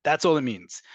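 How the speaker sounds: usual speaking voice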